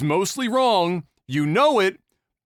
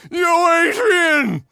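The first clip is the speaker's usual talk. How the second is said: high, loud Rocky-style cry; Rocky calling Adrian; 12 semitones higher, 6 dB louder, much slower than usual. Retold or imitated. Beaten husband crying out for his wife, loud Rocky cry. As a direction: yell for Adrian like a beaten boxer.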